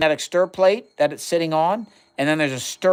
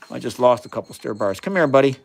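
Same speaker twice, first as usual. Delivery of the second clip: ordinary talk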